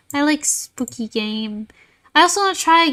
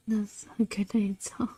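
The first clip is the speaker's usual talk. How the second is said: whispering; 2 semitones lower, 11 dB quieter than usual. Real, not acted. Whispered aside.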